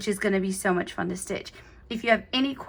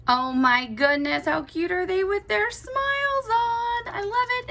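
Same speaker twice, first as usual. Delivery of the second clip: delighted squeal; 10 semitones higher than usual. Real, not acted. Crafter gushing to viewers over the snowman's stitched smiles, delighted high coo. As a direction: coo over how adorable it is.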